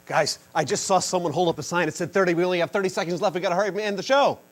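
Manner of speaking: fast, loud, high rushed announcing; urgent hurry. Pretend. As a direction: hurry to wrap it up. Urgent rush to end show.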